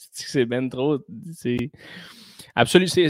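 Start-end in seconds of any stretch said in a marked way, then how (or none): none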